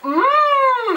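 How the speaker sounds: long, loud high-pitched "mm"; hammed-up doctor's intrigued hum; imitated hum, held high; interested hum.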